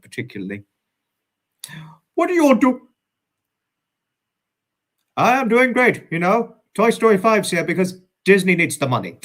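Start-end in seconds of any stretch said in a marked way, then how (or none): 2.2-9.2 higher, louder cartoon toy voice; brash Potato Head, pushed up and loud; Mister Potato Head impression. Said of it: "That's the best Mister Potato Head impression I could say".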